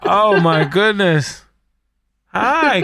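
ordinary delivery

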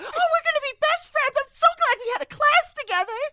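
put-on very high, gushing cute girl voice; Atiya impression. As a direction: squeal it like an eager new friend. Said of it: "a perfect Demi and Atiya".

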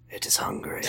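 low, quiet menacing rumble; ominous monster voice. Pretend. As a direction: growl it like a hungry beast. Network as a hungry monster.

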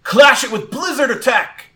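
high, loud battle command; calling out. Acted. Trainer orders Sparkle to counter, calling out. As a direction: shout the counterattack. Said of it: "Ethan calls out and says".

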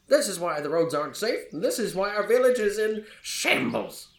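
put-on grumbling old villager on a rant; crotchety old man. Old farmer blaming adventurers for the bandits, crotchety rant. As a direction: pile up complaints, pitch climbing toward the end. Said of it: "this crotchety old man".

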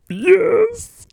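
very high tearful squeak; crying character. Acted. Mister Golf answers the host, already crying. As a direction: answer through hammed-up sobs. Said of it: "are you already crying".

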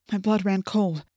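usual speaking voice

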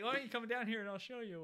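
hammed-up dare; hero taunt. Indy daring the villain to come down, mock taunt. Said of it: "he's like".

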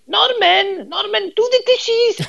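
very high, loud Welsh mother calling; badly faked Welsh falsetto, scolding a son; bad Welsh accent. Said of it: "I can't do a Welsh accent".

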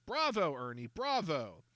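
Bravo high and loud, then slow Ernie, bravo; hammed-up praise. A narrator's reading. George cheering Ernie for the crowd, hammy praise. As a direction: applaud like a showman.

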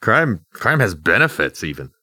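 usual speaking voice

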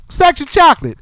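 high, loud quoted line; put-on voice. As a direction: ham up the movie line. Quoting the film line.

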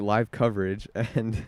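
ordinary talk

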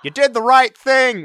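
high, loud, drawn-out film quote; Grinch. Retold or imitated. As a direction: belt out a hammed-up movie line. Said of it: "your favorite line from the Grinch".

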